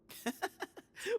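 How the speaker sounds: amused laugh. Acted.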